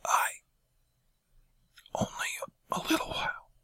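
strained, rasping whisper; hoarse whisper. A narrator's reading. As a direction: barely get the words out. Janus throat burning, faltering.

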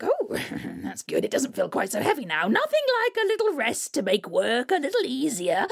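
high cackling troll hag voice. Acted. Troll hag gloating to herself on the path.

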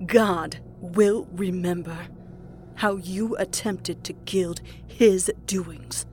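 very slow, menacing warning; zealot's measured snarl. A narrator's reading. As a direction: threaten divine judgment in drawn-out words. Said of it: "Maureen snarled".

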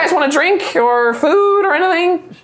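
high, polite little gnome voice; young male gnome. Acted. Young gnome minding the front desk, high and polite. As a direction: play him small and courteous. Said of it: "and he's like".

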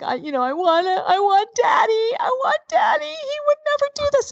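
put-on very high, wailing little-kid voice; mock sobbing, pitch climbing high; imitated crying child. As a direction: wail like a small child wanting a parent. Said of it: "he starts to cry like".

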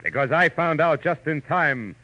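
teaser line. Acted. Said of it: "when Philip Marlowe says".

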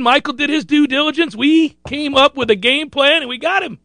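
showy, high-pitched gloating; triumphant boast. Pretend. Triumphant boast of landing recruit.

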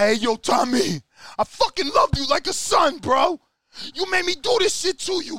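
hammed-up mentor disowning his protege; Rocky scolding. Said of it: "He like".

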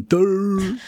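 high, lilting Irish "duh"; singsong Irish imitation; melodious Irish accent. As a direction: draw it out musically in a mock Irish accent. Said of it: "melodious way of saying".

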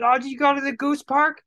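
high, fast gushing local voice; mock an eager Alexandria local; imitating townsfolk proud of the Goose Park, pitched high. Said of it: "everybody in Alexandria".

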